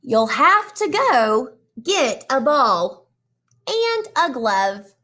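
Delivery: put-on reading to children. Teacher reading a picture book to her class, animated storytelling. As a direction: draw the kids into each page.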